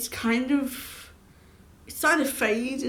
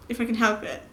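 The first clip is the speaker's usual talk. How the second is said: ordinary talk, nothing marked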